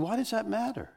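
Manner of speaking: high, soft, puzzled comeback; puzzled retort. Retold or imitated. Young preacher questioning the inmate, puzzled high retort. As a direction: ask it softly with the pitch jumping up.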